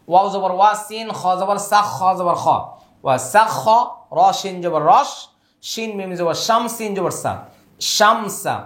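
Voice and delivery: normal talking voice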